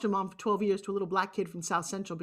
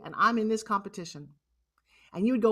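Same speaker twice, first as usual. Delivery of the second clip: ordinary talk, nothing marked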